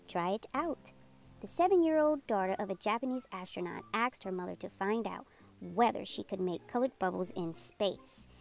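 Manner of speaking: usual speaking voice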